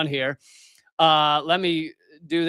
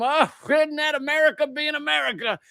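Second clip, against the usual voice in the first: mocking bystander; 10 semitones higher than usual. Mocks passive bystanders' reaction.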